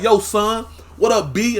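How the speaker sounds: loud, high Brooklyn street greeting; Brooklyn accent. Retold or imitated. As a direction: call it out like a Brooklyn kid.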